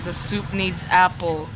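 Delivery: normal talking voice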